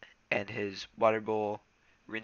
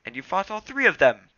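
loud, high excited interruption; 9 semitones higher, 12 dB louder than usual. Acted. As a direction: cut in excitedly. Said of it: "his tail twitching with excitement".

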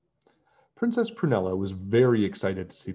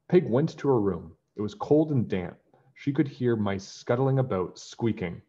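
plain reading voice

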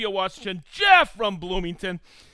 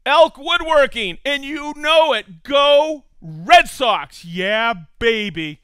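cheer; 5 semitones higher, 9 dB louder, slower than usual. Real, not acted. Cheering for viewers' shop and team.

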